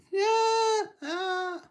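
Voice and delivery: very high squeaky yelp; startled deer cry. Pretend. Deer startled by the fast train, high squeaky yelp. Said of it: "the deer goes".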